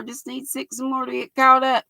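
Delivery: ordinary talk, nothing marked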